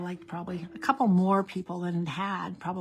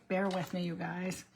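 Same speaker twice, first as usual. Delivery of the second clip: normal talking voice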